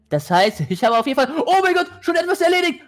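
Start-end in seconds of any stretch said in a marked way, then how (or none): 1.5-2.8 shocked. Real, not acted. Sudden shock in game.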